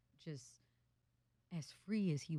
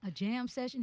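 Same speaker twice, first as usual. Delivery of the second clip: jam session? asked much higher, rising in disbelief; incredulous echo; 8 semitones higher, 5 dB louder than usual. Retold or imitated. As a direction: repeat it back as if you misheard.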